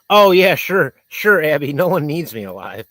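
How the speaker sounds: loud, sarcastic retort. Real, not acted. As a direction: throw it back mockingly. Sarcastic reply to viewer.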